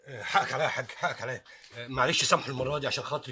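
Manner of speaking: low humble plea; pleading apology. Acted. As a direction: grovel for forgiveness. Begging the boss for his job.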